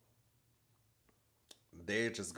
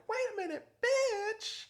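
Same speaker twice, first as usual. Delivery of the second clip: very high squeal, louder at the end; mock shocked interjection; 6 dB louder than usual. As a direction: imitate a friend butting in, sky-high. Voicing Portia's shocked interjection.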